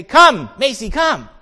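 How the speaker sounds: loud, high, firm command; calling the dog. Retold or imitated. Owner calling puppy away from chewing, loud command. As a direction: call out sharply to a stubborn pet.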